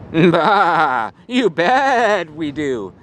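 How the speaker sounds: slow bleating sheep voice. Acted. Sheep answers the puppy in a slow bleat.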